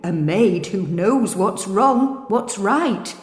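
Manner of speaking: slow, otherworldly mirror voice; magic mirror. Acted. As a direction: draw out the name ominously. The mirror replies.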